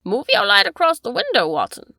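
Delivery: excited cry. Acted. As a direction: call out quickly to Watson. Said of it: "cried the Baronet".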